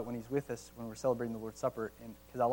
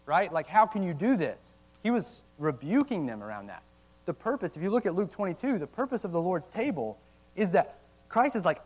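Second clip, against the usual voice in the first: ordinary delivery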